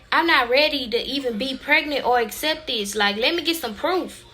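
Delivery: ordinary delivery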